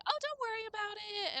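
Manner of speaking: high, sweet mock-friendly voice; nice lady voice. Imitating the shopper being sweet to the streamer, high sweet voice. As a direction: play it suddenly sweet and forgiving. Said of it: "and was, like, so nice".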